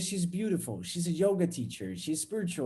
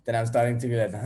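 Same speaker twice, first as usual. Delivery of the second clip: ordinary talk, nothing marked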